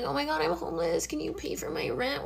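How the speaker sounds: imitating a girl's high, soft whine to her sugar daddies; mock helpless plea. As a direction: play the pitiful homeless girl begging for rent money.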